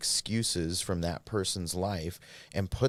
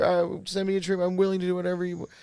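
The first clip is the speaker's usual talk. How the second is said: whiny begging; 11 semitones higher, 3 dB louder, much faster than usual. Retold or imitated. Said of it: "they would always hear crying, manipulation".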